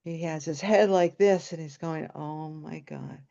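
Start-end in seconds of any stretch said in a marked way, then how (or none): 2.1-3.2 slow, soft, exasperated "under the breath"; mortified groaning aside. Retold or imitated. Mortified brother, head down.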